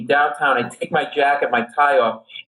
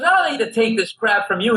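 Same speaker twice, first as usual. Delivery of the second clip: raised-pitch imitated drunk griping; drunken indignation; 7 semitones higher than usual. Drunk with bloody napkins to the comic, indignant. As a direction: protest like an offended drunk. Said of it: "he's drunk".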